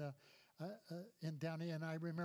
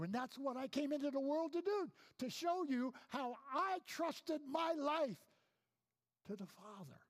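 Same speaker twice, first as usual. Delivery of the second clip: voicing Jesus; 8 semitones higher, 5 dB louder than usual. God voiced in a sermon.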